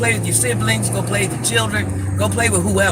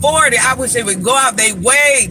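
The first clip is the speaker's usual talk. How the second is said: raised, high-pitched outrage; indignant; 4 semitones higher than usual. Real, not acted. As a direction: protest hotly with the voice climbing.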